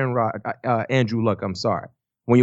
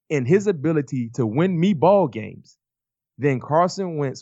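ordinary talk, nothing marked

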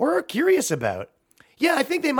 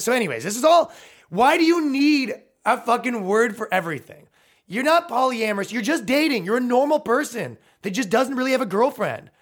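higher, louder, incredulous; comic rant at people who label themselves, exasperated; 8 semitones higher, 3 dB louder than usual. Real, not acted. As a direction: rant against needing words for everything. Ranting about labels.

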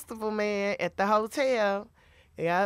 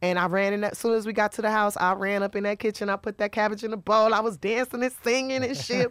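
usual speaking voice

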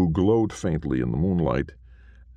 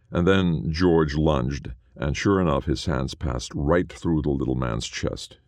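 ordinary delivery

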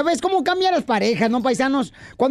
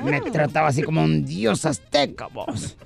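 low put-on man's voice; voiced buddy character; 7 semitones lower than usual. DJ boasts how his woman treated him, low character voice. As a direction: keep the buddy's voice low and measured.

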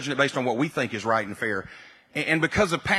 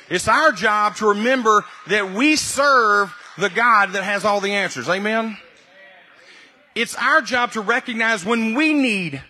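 higher and louder, building to full-force preaching; rising preaching; 5 semitones higher, 3 dB louder than usual. Acted. Pastor builds his point to the congregation, fervent preaching. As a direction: drive it home to the congregation.

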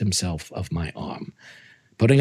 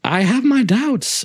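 high, slow, teasing doubt; coy doubting; 14 semitones higher, slower than usual. Acted. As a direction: dangle the doubt like bait. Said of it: "I replied".

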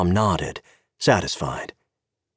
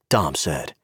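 ordinary delivery, nothing marked

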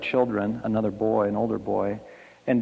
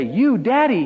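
put-on high, loud child's call; pitched-up, pleading cry for attention; 12 semitones higher, 6 dB louder than usual. A narrator's reading. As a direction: call urgently to dad as a small boy. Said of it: "he called".

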